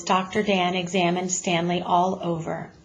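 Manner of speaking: ordinary delivery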